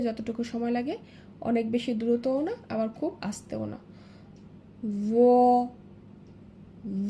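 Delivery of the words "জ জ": জ as a thick, buzzed "dho" held one beat; drawn-out letter name. Shown as a demonstration. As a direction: stretch জ with tongue tip at the teeth. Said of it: "গাল ভরে উচ্চারণ করতে হবে". (জ এক আলিফ টেনে মোটা করে)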